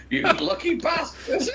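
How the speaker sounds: retold envious remark. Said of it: "some guy comes up to him and says".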